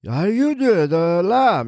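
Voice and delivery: put-on high, querulous old man voice; cranky old man.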